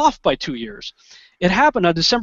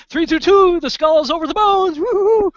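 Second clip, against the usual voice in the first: very high, hooting mock cheer; mock celebration; 13 semitones higher, 3 dB louder than usual. Government crowing over the date, very high hooting cheer. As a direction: crow it like a giddy secret society. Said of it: "our government ... is going".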